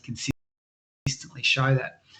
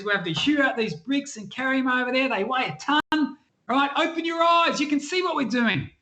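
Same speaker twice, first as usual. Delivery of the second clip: much higher, incredulous worker's voice; exasperated labourer; 10 semitones higher than usual. Acted. Quarry worker scoffs at the visitor in a high, incredulous voice. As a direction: scoff at the silly question from up high.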